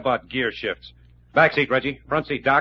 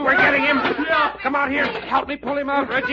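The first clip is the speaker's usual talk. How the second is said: high, raised-voice orders; urgent fight shouting; 11 semitones higher than usual. Acted. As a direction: call for help mid-struggle. Straining to drag opponent out.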